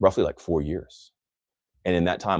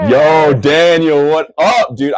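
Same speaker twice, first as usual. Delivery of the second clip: a big, slow, loud call of a friend's name, higher; excited shout-out; 8 semitones higher, 12 dB louder, much slower than usual. Real, not acted. Shouting out to Daniel after reading his comment, loud and excited. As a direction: stretch out "Yo, Daniel" like spotting a buddy across the room. Greeting a viewer in chat.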